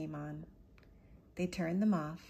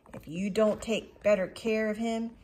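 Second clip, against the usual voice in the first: terrible man; 3 semitones higher, 7 dB louder than usual. Acted. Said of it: "said the terrible man".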